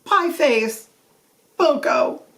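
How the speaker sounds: joyful reunion cry. Acted. Two old school friends make up and cry out each other's nicknames, moved and excited. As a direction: call each nickname out with sudden affection.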